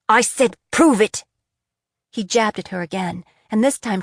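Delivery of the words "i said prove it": louder, repeated demand; angry shout. A narrator's reading. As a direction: bark the order again.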